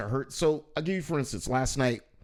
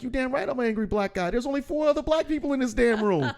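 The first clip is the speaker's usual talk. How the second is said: higher, fast indignant stage rant; angry guy persona; 9 semitones higher, faster than usual. Acted. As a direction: play up the angry comic's tirade.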